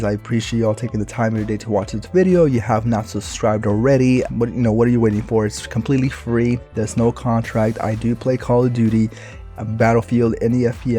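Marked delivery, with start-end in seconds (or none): none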